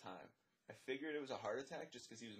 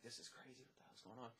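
hushed, much softer inner thought; stunned aside; 12 dB quieter than usual. Retold or imitated. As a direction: say it under your breath in disbelief. Retold stunned thought on seeing blood.

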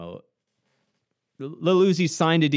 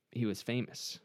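usual speaking voice

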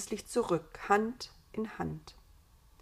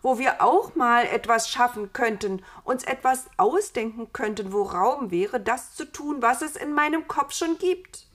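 raised, forceful character voice, full of ideas; eager Eva; 5 semitones higher, 7 dB louder than usual. Acted. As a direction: voice a woman urging her wish, higher and louder. (eifrige Eva, höhere Stimme)

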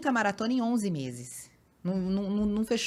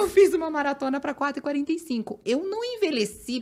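higher and louder, arguing her case; exasperated retelling; indignant protest; 6 semitones higher, 3 dB louder than usual. Retold or imitated. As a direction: insist she hasn't aged. Retold indignant argument.

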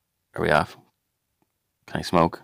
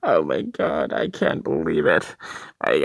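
ordinary talk, nothing marked

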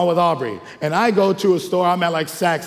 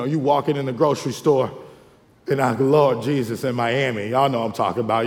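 ordinary talk, nothing marked

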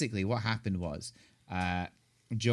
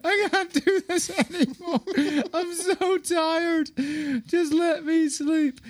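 high, slow whiny wail, laughing through it; mock crying; 6 dB louder than usual. Tired dad retelling his breakdown to his cohost, mock wailing. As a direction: ham up a worn-out sob. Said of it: "I was crying".

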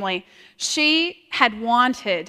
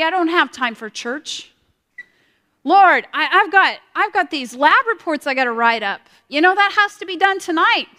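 higher, louder, hurried excuses to God; busy student's excuses; 5 semitones higher, 5 dB louder than usual. Retold or imitated. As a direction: rattle off excuses like a student too busy for church. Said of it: "I realized at that point".

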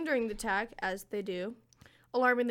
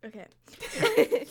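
laughing; 4 semitones higher, 4 dB louder than usual. Real, not acted. Laughing after a rant.

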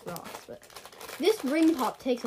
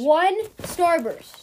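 slow, high, loud disbelief; indignant; 7 semitones higher, 7 dB louder, much slower than usual. Real, not acted. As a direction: draw it out in outrage. Disappointed at only one.

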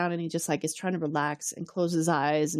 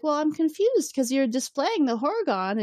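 higher, slow imitation of her puzzlement; confused woman; 9 semitones higher than usual. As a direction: play her puzzled, pitched up.

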